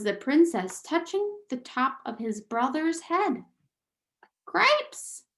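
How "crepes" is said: high squeaky shout. Acted. Furlough shouts in alarm at his brother, high squeak. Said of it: "shouted Furlough again".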